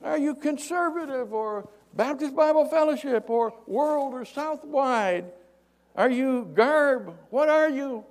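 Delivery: high, loud acted holler; sustained long-distance shout; yelling. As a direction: call up to a man far above. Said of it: "The guy yells".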